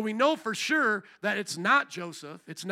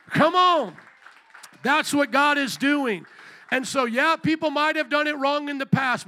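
loud, high preaching call; preacher rousing the congregation; full-force preaching; 6 semitones higher, 8 dB louder than usual. Acted. Loud, raised preaching voice.

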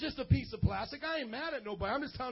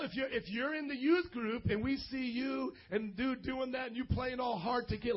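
normal talking voice

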